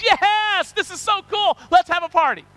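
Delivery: high, loud, thrilled cheering; excited crowd. Acted. Preacher voicing the rejoicing friends, excited.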